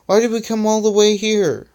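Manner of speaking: high-pitched, put-on exasperation; exasperated. A narrator's reading. Stunter nearly punches Electronic, exasperated. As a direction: demand it high, close to losing it. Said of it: "on the verge of punching Electronic".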